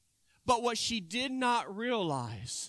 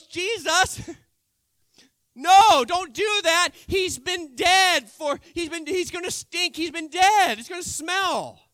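higher, louder, half-laughing pleading no; Martha's alarmed protest; 6 semitones higher, 8 dB louder than usual. Acted. Martha pleading with Jesus not to roll the stone, higher and louder. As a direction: beg him not to open it.

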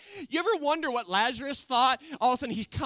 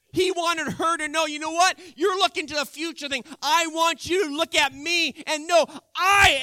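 louder, higher, urging her to believe; impassioned Jesus voice; 4 semitones higher, 6 dB louder than usual. Acted. Preacher voicing Jesus urging Martha, louder and insistent.

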